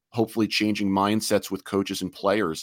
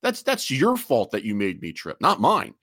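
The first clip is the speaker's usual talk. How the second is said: ordinary talk, nothing marked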